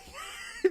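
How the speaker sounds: high-pitched mock girly scream; guy-girl scream. Imitating the guy caught in class, guy-girl scream. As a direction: squeal in a high falsetto like a startled girl. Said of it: "those guy-girl screams".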